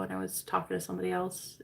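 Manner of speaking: normal talking voice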